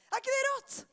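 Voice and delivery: high-pitched, excited exclamation; amazed. Real, not acted. Preacher marvels to children about shushed Bartimeus, amazed.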